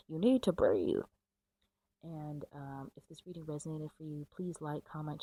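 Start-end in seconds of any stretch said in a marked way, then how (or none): 0.1-1.0 a sudden much louder command after a laugh; loud insistence. Real, not acted. Reader, out of breath, loudly tells the viewer to breathe. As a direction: blurt the advice out loudly. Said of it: "laughing insistence".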